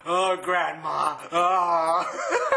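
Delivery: hammed-up moan of grief; mock moaning. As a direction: wail it out as fake, droning mourning. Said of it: "I'm moaning".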